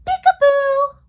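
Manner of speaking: high, loud peekaboo burst; squealing peekaboo; excited peekaboo. Pretend. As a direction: surprise the little ones. Peekaboo grins in story.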